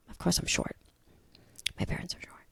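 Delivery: a quick private joke, whispered; whispered aside. Real, not acted. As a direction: whisper it under your breath. Self-deprecating aside.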